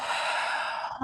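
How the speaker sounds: long breath let out; exhale sigh. Shown as a demonstration. Teacher breathing out in down dog, audible sigh. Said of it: "I'm staying for the exhale".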